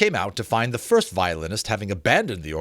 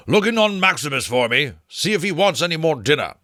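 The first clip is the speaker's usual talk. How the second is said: louder, higher call; calling after; 6 semitones higher, 4 dB louder than usual. A narrator's reading. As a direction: call out as he leaves. Berkeley calling after him.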